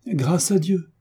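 a bright, high thank-God; joyful relief. Acted. Ernestine rejoicing that the marriage is free, high and glad.

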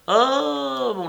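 long, high, loud "ah"; knowing exclamation. A narrator's reading. Bengali guesses the young man's love affair, knowing exclamation. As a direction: let it ring out as the secret dawns.

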